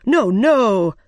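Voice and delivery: loud, hasty protest; urgent objection. A narrator's reading. Rabbit stopping the Queen's order, objecting.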